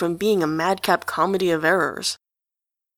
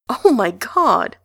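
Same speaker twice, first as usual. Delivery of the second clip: choked laugh; 3 semitones higher, 4 dB louder, slower than usual. A narrator's reading. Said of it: "Enjolras chokes out".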